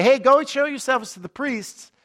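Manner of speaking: lightly acted high call; Jesus calling out. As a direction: call an instruction to people some way off. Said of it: "For Jesus to say".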